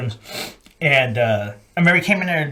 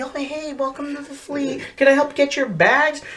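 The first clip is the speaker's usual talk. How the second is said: high, soft, sugary greeter voice; mock welcoming committee; 11 semitones higher, 3 dB quieter than usual. Welcoming committee greeting new marines, mock sweetness. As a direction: play an overly sweet greeter. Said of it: "It's like a welcoming committee".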